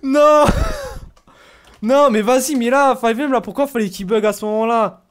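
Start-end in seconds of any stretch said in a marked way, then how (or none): 0.5-1.1 squeaky real laughter, high. Real, not acted. Streamer laughing at mistaken identity.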